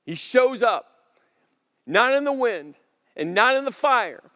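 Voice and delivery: loud, rising preacher's drive; preaching. Acted. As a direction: build the sermon to full force. Preaching at full force.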